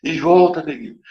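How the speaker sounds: calling out. Retold or imitated. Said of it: "começou a gritar pra ele".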